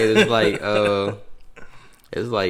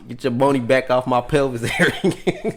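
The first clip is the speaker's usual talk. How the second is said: raised, louder joking quote breaking into laughter; mock protest, laughing; 6 semitones higher, 6 dB louder than usual. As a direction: play the complaint up loud and let it crack into a laugh.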